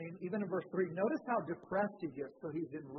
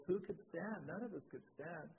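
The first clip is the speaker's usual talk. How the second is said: usual speaking voice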